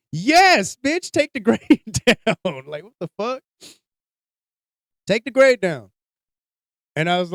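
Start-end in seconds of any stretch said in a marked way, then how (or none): 0.1-2.7 high, loud, drawn-out yell at the teacher; retold yell. Parent yelling at the teacher to take the grade down. 5.1-5.9 emphatic repeat. Retold or imitated. Repeating the demand loudly.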